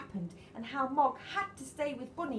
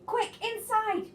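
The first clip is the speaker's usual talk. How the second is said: high loud call, a little drawn out; shouted urging; 13 semitones higher, 8 dB louder, slower than usual. Acted. Missus Thomas shouts to the family to get in from the rain. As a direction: hurry everyone indoors. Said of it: "shouted Missus Thomas".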